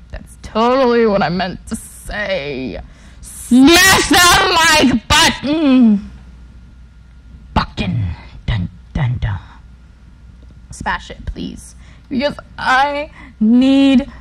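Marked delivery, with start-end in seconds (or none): 3.2-6.1 high, loud, slow mock announcer; hammed-up announcer. Hammed-up outro call. 7.5-11.8 soft unvoiced whisper. Whispered aside to viewers.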